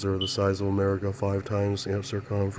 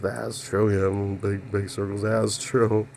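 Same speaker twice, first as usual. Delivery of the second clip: normal talking voice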